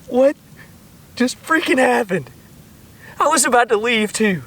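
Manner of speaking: high, loud laughing disbelief; shocked laughter. Real, not acted. Angler stunned as the fish slips loose, loud laughing disbelief.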